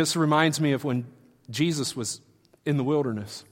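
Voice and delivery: usual speaking voice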